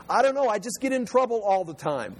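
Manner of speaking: lightly acted exasperated child; complaining schoolkid. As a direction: play a kid pleading ignorance. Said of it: "Kids at school all the time".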